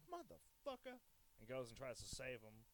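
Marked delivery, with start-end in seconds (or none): 0.1-0.9 imitating Samuel Jackson. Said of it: "and he's like".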